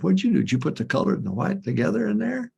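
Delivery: high mock scold; wife scolding. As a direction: needle him like a fed-up wife. Wife scolding husband in story.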